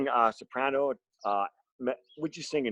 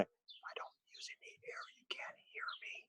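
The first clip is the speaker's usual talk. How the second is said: voiceless whisper with no air behind it; airless whisper; 22 dB quieter than usual. Shown as a demonstration. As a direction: whisper it so they can barely hear. Demonstrates talking without any air.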